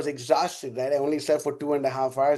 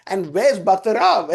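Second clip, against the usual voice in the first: louder, slow and laughing; laughing question; 5 semitones higher, 5 dB louder than usual. Retold or imitated. Asking devotees where the extra books came from, laughing. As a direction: ask it through a laugh.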